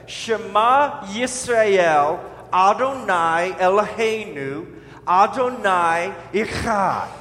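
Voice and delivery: slow Hebrew phrase, syllable by syllable; measured Hebrew with throaty CH in Echad; Hebrew recitation. Shown as a demonstration. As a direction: model "Shema Yisrael, Adonai Eloheinu, Adonai Echad" for repeating. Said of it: "I'll say it".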